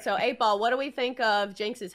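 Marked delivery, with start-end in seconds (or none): none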